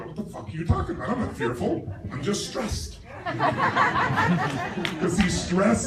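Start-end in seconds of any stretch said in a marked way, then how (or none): 0.0-3.0 lightly voiced defensive achiever, laughing; mock achiever. Mocking an achiever who denies fear, laughing. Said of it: "Achievers go". 3.0-4.9 high laughter. Real, not acted. Laughs at own impression.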